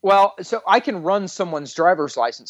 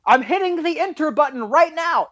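shouting out the window; 11 semitones higher than usual. Retold or imitated. Cop calling from the car to the driver, mock shouting. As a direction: call it out as if across a distance. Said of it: "shouting out the window".

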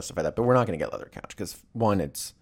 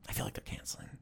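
whispered confidential suspicion; 14 dB quieter, much faster than usual. Real, not acted. Friend confiding that the couple will cancel, whispered. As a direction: lean in and whisper it.

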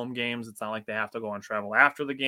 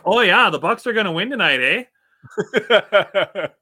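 high, loud hammed-up Canadian accent; mock Canadian accent; 8 semitones higher, 10 dB louder, slower than usual. Podcaster mocking outsiders, hammed-up Canadian accent. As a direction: ham up a Canadian "eh" on the end. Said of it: "we'll just say".

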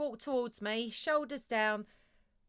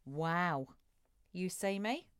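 low, soft, melting wow; awed reaction; 5 semitones lower, 5 dB quieter, slower than usual. Acted. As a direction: imitate a woman quietly amazed. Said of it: "they're gonna go".

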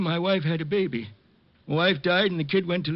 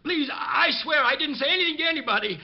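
raised, higher voice begging for mercy; desperate pleading; 6 semitones higher than usual. Acted. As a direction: beg him to spare the girl. Old man begging for girl's life.

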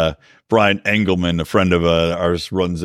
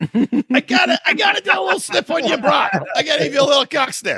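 put-on Chicago accent; 10 semitones higher than usual. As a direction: shout it like a Chicago local. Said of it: "you gotta say it in a Chicago accent".

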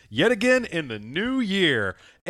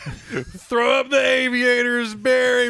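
higher, slow laughing drawl; Joe Biden voice; 9 semitones higher, 4 dB louder, slower than usual. Retold or imitated. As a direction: call out like a buddy, slowly. Voicing Biden to Obama.

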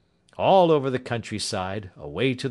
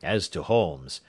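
usual speaking voice

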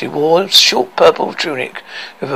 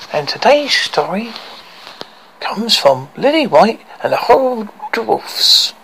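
put-on storyteller for kids; 7 semitones higher than usual. Reading to children intro.

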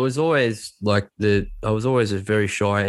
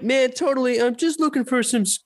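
goofy slacker voice, pitched way up; Shaggy. Retold or imitated. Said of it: "I was pretending to be Shaggy".

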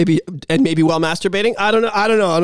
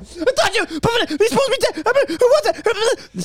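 high confused voice, much higher; squeaky bewildered rambling; high-pitched confused stammer; baffled caller; 13 semitones higher than usual. Retold or imitated.

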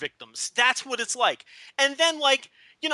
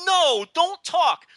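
pained plea; 5 semitones higher, 3 dB louder, much slower than usual. Retold or imitated. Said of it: "I'm just like".

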